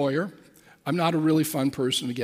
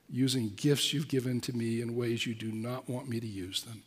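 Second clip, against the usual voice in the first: humble prayer; 6 semitones lower, 9 dB quieter than usual. Retold or imitated. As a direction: pray quietly with a broken heart. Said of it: "I just said".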